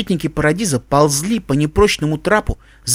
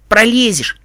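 put-on higher, offhand boyish retort; dismissive snort; 5 semitones higher, 3 dB louder, slower than usual. A narrator's reading. Tim dismisses Iskorka's fear of the hole, snorting retort. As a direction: brush off the worry with a snort. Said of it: "фыркнул Тим".

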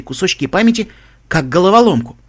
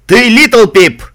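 booming reaper, loud and raised in pitch; thunderous proclamation; 8 semitones higher, 9 dB louder, much slower than usual. Acted. Reaper proclaims the pony's name, thunderously. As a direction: make it shake like an earthquake. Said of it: "Его голос потрясал, будто землетрясение".

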